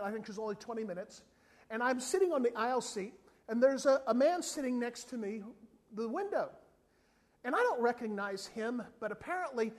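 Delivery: usual speaking voice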